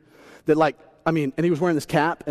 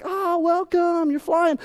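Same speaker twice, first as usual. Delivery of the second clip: high, slow, syrupy welcome; sweet greeting; 10 semitones higher, much slower than usual. Retold or imitated. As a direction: gush over the traveler like a kind host. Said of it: "they were so nice".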